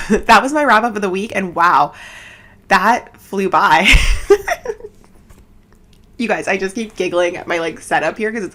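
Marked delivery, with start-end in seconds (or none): 3.9-4.9 high-pitched little laugh; short, high burst of laughter; real giggle. Real, not acted. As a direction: giggle at yourself. Giggles after closing the recap.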